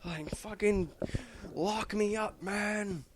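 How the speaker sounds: high, loud pleading that builds into a yell; a cornered hero screaming at his friends; desperate shouting plea. Retold or imitated. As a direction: beg them to lock you up, building to a cry. Superman begging to be stopped.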